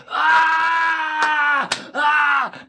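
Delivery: mock booming laugh, pitched high; retelling the dad's booming laughter. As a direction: bellow out a big mocking laugh. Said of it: "booming laughter just going".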